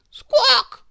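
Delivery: loud, high squawk; chicken squawk. Acted. Chickens joining the pandemonium, squawking. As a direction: squawk like a hen. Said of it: "clucked the chickens".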